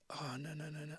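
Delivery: a mock panicked hush as the file vanishes; hushed dismayed oh no. Producer realises file deleted.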